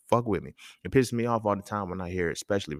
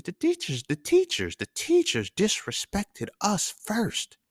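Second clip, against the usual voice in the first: high, slow indignant repeat of the teachers; hammed-up complaint, drawn out; teenage protest voice, overplayed; mock outraged yell; 12 semitones higher, slower than usual.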